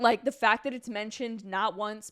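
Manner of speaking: normal talking voice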